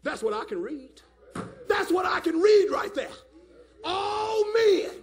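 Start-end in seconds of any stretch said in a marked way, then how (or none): 1.7-3.1 high, loud pulpit cry; shouted insistence; emphatic shout. Real, not acted. Repeats it as a shout. 3.8-4.9 high, loud pulpit cry; loud, emphatic proclamation; ringing shout. Real, not acted. Preacher cries out the verse.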